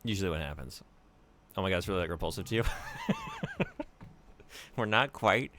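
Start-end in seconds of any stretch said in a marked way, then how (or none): 2.7-4.7 high squeaky laugh. Real, not acted. Laughs at gross banana.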